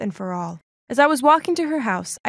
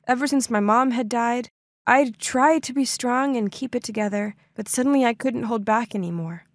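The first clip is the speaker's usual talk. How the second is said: plain reading voice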